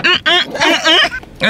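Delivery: petits « hum, hum » aigus en riant; raclement imité. Retold or imitated. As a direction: imite un raclement de gorge gêné. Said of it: "j'ai fait". (« hum, hum » moqueurs, plus aigus)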